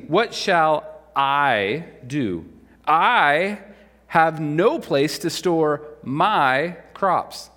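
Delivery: slow, each I and my stressed; emphasizing I and my. Shown as a demonstration. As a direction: lean on "I" and "my" to show the selfishness. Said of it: "I'll emphasize it for you here".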